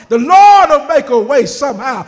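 loud, preachy mock of Pastor Foster; imitating Pastor Foster. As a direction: belt it out like a confident preacher boasting. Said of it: "He sounds like Pastor Foster".

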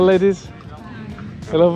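ordinary talk

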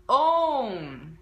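a vocal coach modelling a word; English O in own. Shown as a demonstration. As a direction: own very slow and loud with a long rounded O gliding to U. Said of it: "例えばこのオーが入っている単語".

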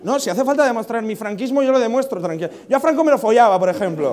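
loud, high-pitched hammed-up insistence; mock defiant boast. Mock boast proving his Francoism.

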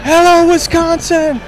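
high, slow stadium greeting; host hams up a greeting to Wisconsin; mock concert shout-out. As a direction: call out like a rock star to the crowd. Host greets a home state.

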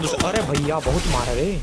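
ordinary talk, nothing marked

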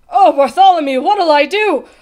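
loud, high helpless plea; wailing. A narrator's reading. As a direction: wail it out for help. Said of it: "the trumpeter wailed".

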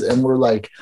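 normal talking voice

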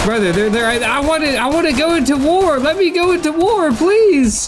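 high, fast begging whine; desperate pleading. Real, not acted. Begging his brother to let him join the war, high and fast.

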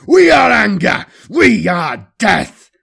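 loud war cry, slowing at the end; battle cry. Acted. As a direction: scream it as a war chant. Battle cry during the charge.

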